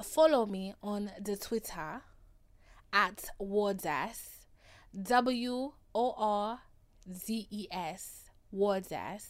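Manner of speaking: slow, deliberate attempt at an African accent. Host plugging her Twitter handle, mock African accent. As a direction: spell it out slowly in a hammed-up African accent. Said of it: "if you understood my African accent".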